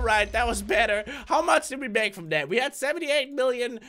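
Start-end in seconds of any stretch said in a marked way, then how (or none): none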